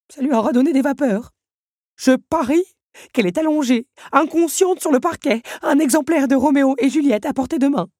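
high, loud alarmist voice; dramatic clock. A narrator's reading. Big Ben scaring the others, high and loud. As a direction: paint the worst case.

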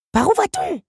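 petite voix très aiguë et plus forte; Lucanos inquiet. A narrator's reading. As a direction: question pressante du scarabée. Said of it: "demanda Lucanos". (Lucanos demande le chemin, voix aiguë de scarabée)

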